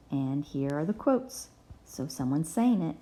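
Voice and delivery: usual speaking voice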